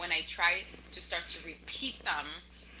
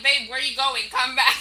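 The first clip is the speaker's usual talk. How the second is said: laughing call; 5 semitones higher, 13 dB louder than usual. Retold or imitated. Calling husband back, laughing.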